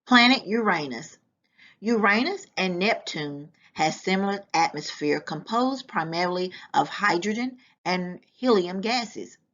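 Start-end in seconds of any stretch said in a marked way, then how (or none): none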